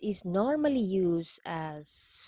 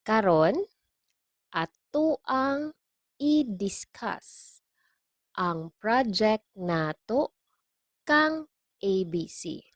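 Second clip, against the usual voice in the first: slow model; 3 semitones higher, 4 dB louder than usual. Shown as a demonstration. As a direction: teach it word by word with long pauses. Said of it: "let's take this slowly".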